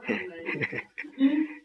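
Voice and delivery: a quiet laugh, pitched high; soft high laugh. Real, not acted. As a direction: chuckle softly, embarrassed. Husband laughs at teacher's remark. (cười khẽ giọng cao)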